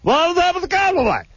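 very high, garbled blah-blah tirade; mock angry babble. Shown as a demonstration. Host shows co-host what he hears when host gets angry, high mock-angry babble. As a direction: rant in nonsense way up high, a few words poking through. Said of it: "here's what Drew hears".

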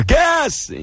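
high yelled alarm call; shouted alarm. Retold or imitated. Retells a soldier's alarm call.

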